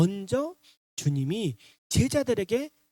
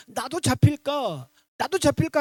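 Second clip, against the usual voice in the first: retold panic; 6 semitones higher, 5 dB louder than usual. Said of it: "혼비백산".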